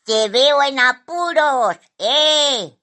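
high, loud bird character; loud, high knowing shout; magpie calling out. A narrator's reading. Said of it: "le gritó a Simón".

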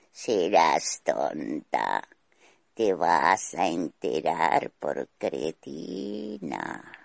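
deep, slow cavernous voice; underworld god. A narrator's reading. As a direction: growl it low and slow, menacing. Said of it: "con voz cavernosa".

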